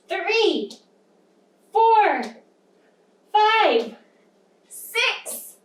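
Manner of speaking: high, swooping numbers with pauses between; big-bite counting. Pretend. Teacher leading children through ten bites, high exuberant counting. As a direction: count each huge bite, building in effort. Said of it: "ten big bites".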